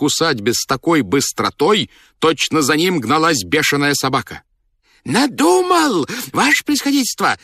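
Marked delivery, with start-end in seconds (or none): 5.0-7.4 extremely high-pitched ecstatic shout; wildly elated high squeal; joyful frantic cry. A narrator's reading. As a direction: shriek joyfully in a voice not your own. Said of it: "закричал он радостно, не своим голосом".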